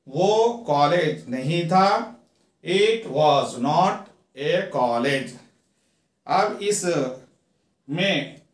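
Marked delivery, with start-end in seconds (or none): 2.6-5.3 very slow, each word apart; slow teaching read. Shown as a demonstration. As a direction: dictate it slowly to students. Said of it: "teacher reads model sentence".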